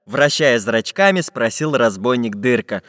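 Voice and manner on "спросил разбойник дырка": ordinary delivery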